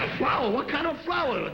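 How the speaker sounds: high-pitched incredulous questions; acted disbelief. Husband hearing about the flower, incredulous. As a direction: echo it in comic astonishment. Incredulous at his wife's purchase.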